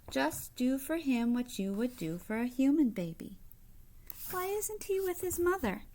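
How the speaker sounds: ordinary delivery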